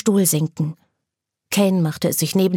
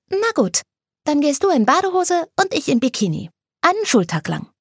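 much higher, playful voice; Anna teasing; 9 semitones higher than usual. Acted. Anna teasing Kane about swimwear, high and playful. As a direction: tease him with a grin.

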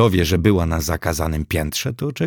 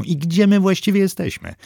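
put-on high nervous question in a girl's voice; anxious, glancing around for a door; 12 semitones higher than usual. A narrator's reading. Agnes nervously asking where they are, in a high voice. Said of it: "rzuciła nerwowo".

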